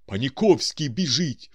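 loud, high-pitched cry; exclaiming. A narrator's reading. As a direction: shout the news in alarm. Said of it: "вигукнул Балаганов".

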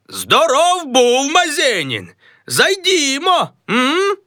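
very high, slow pal's voice; a high, drawn-out buddy call; chummy friend. Acted. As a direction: invite him in, matey. Another voice greets him.

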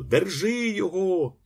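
high-pitched chasing call; shouting. A narrator's reading. Ostap calling up from below, high shout. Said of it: "кричав Остап знизу".

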